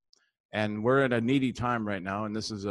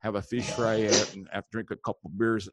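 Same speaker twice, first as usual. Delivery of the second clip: folksy upper-Midwest Wisconsin character; thick Wisconsin up-north accent. Acted. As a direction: ramble like a Wisconsin fishing buddy. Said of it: "I got a guy up there in, uh, Wisconsin".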